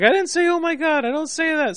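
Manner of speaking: high falsetto wife voice; imitated protesting wife. Wife denying the teasing to her husband, mimicked high protest. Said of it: "And she's like".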